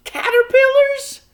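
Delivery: very high, loud wail. A narrator's reading. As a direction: shriek it in horror. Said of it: "wailed Homily".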